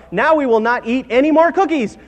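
high, loud, emphatic resolve; emphatic storybook proclamation; firm declaration. Acted. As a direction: declare it triumphantly, every word punched. Frog firmly declares the cookies secured.

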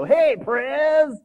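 loud, high, cocky greeting; breezy young dude. Acted. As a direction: holler it like a carefree slacker. Young headphone wearer hails president.

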